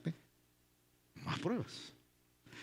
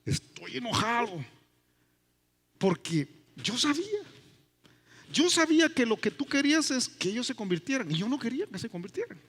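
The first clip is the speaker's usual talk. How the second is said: higher, complaining and aggrieved; sulky Jonah; 6 semitones higher than usual. Acted. Jonah complains to God that Nineveh was spared, higher and whiny. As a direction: whine it like a resentful prophet. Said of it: "Y le dice Jonás".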